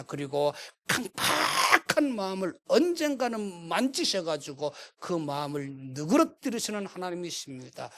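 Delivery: usual speaking voice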